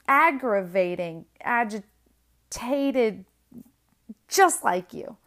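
loud, exasperated retold outburst; mad at ex. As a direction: hammer out each insult, dragging the word out. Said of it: "I'm so mad at you".

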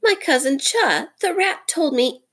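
high, timid little creature voice; broken-hearted muskrat. A narrator's reading. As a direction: speak as a small, fearful beast. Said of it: "Chakundra is a broken-hearted little beast".

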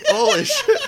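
very high squeal of disgust, laughing; shocked laugh. Retold or imitated. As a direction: shriek it in disbelief. Reacting to awful fart smell.

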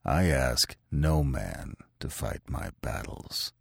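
a dangerous calm before a fight; soft, slow threat to the hillman; softly. A narrator's reading. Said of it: "he said softly".

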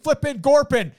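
high, loud garbled guard shouting; garbled security guard. Retold or imitated. Retelling a sketch's security guard, loud high garble. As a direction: bark out nonsense words like a mumbling guard.

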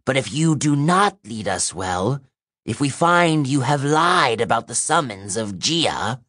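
put-on grudging threat from an old monster; ancient monster voice. A narrator's reading. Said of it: "Seraphine said".

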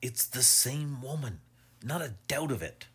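eager, insistent assertion; vehement. A narrator's reading. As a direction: press the point eagerly. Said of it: "vehement face of his friend".

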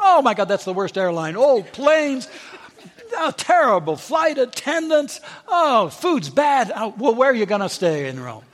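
high, dismissive stylist running everything down; scoffing stylist. Retold or imitated. Stylist mocking her trip, high and scornful. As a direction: sneer at every choice she names. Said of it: "He said".

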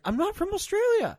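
high mock girlfriend voice, a mock accent; girlfriend's reply mimicked high and put-on; imitating girlfriend. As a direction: squeak it out in her voice, indignant. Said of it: "she's like".

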